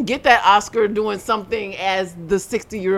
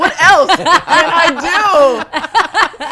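slow, loud, high mock plea; 6 semitones higher, 6 dB louder, much slower than usual. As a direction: ham up a desperate star. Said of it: "She's like".

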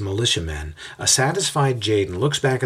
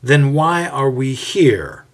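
slow, firm and louder; firm question; 5 semitones higher, 6 dB louder, slower than usual. A narrator's reading. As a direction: demand an answer. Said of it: "both of the officials firmly ask".

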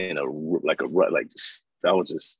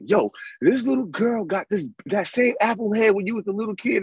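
much higher teasing friend voice; voicing homeboy Gary; 12 semitones higher than usual. Retelling to the host, friend's high teasing voice. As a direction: tease him about the apple head. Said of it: "he's like".

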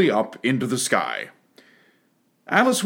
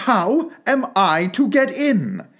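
slow, high-pitched, emphatic question; louder, insistent; 8 semitones higher, slower than usual. A narrator's reading. Alice asks the footman once more, mildly louder. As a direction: space the words out insistently. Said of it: "in a louder tone".

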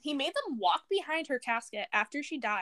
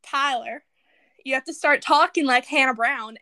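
loud country twang demo; country accent, imitating a Southern woman; 9 dB louder than usual. Shown as a demonstration. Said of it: "this is how I get in character".

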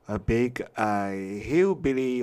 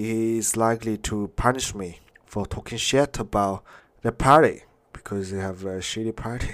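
ordinary delivery

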